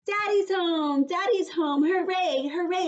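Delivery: high, loud happy shouting; little girl cheering as her daddy's car arrives; excited yelling. Acted. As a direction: cheer like a thrilled little kid. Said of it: "I yelled".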